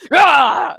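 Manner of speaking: short loud "ah" like a burst of anger; mock angry shout. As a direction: let out a fake angry yell. Mock outburst.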